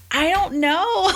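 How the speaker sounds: high drawn-out puzzled I don't know; high, slow mock-baffled shrug; high, slow clueless shrug. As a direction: play it lost and unsure, stretched out. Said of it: "And I was like".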